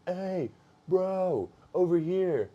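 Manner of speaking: mock holler to get someone's attention; imitated yell. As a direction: imitate a friend yelling from a distance. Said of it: "Colin yelling at me".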